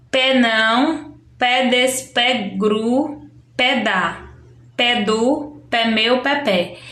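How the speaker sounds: "pé" placed before each syllable, one syllable at a time; slow, playful children's-game reading; língua do P demo. Shown as a demonstration. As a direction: chop the sentence into syllables, each led by a clear "pé". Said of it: "que é a língua do P".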